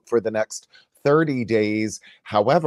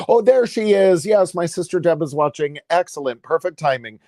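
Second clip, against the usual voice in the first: real happy surprise, high and louder; higher, excited mid-sentence exclamation; delighted surprise; 7 semitones higher, 3 dB louder than usual. Real, not acted. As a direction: burst out on spotting someone, then settle slightly. Spots sister joining the live stream.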